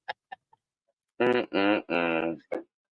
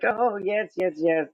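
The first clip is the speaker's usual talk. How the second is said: much higher, mock chirpy reassurance; a hammed-up high voice brushing off the worry; imitating the doctor; 10 semitones higher than usual. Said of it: "And she's like".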